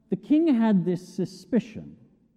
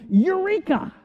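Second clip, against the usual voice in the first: high, excited cry of Eureka; shouting Eureka; 11 semitones higher, 3 dB louder than usual. Retold or imitated. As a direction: shout it like a man who just found the answer.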